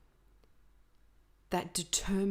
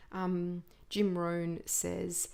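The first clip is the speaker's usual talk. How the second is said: normal talking voice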